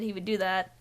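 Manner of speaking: ordinary talk, nothing marked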